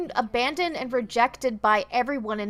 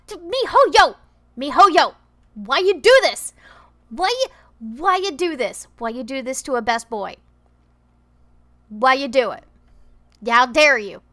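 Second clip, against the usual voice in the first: louder, punchy cries of outrage; mock-angry shout; 9 dB louder than usual. Real, not acted. Player yelling at MiHoYo over the story, mock fury. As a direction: shout at the developers in hammed-up fury.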